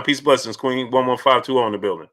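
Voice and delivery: ordinary talk, nothing marked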